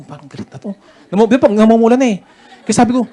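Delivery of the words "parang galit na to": much softer nervous aside; softly wondering if the officer is angry; worried aside. Retold or imitated. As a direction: mutter it so the officer can't hear.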